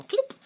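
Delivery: high cartoon gulp noise; cartoon cloop. Describing the hawk snatching him, high cartoon cloop. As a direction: pop it like a cartoon.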